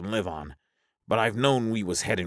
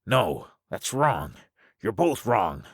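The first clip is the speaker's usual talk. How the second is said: slow, higher, mildly distressed objection; trembling voice; 5 semitones higher than usual. Acted. Russell fearing being left alone, slightly trembling protest. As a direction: object haltingly with a touch of tremble. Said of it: "His voice trembled".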